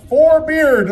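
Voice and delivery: drawn-out crowd call. Real, not acted. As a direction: stretch the name out for the audience. Calling names for applause vote.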